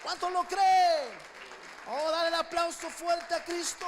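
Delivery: very loud, rousing preacher's shout; preaching at full force. Real, not acted. Pastor calling the church to applaud Christ, full-force shout. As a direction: fire up the crowd.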